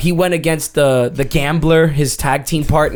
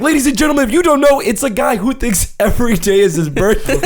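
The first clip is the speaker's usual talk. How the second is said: high, hyped announcer patter; excited intro easing down at the end; ring announcer; 7 semitones higher, faster than usual. Retold or imitated. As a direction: imitate a wrestling announcer explaining a gimmick. Announcer explains the character.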